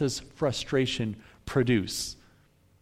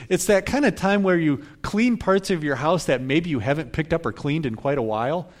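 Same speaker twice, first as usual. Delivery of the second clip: ordinary talk, nothing marked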